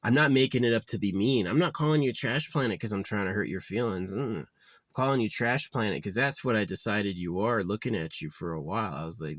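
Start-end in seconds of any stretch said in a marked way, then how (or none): none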